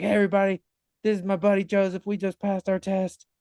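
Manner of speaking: high, lightly voiced cheerful girl on camera; mock perky selfie-video announcer; bubbly video greeting. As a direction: imitate an excited girl filming a Snapchat. Girl recording Snapchat.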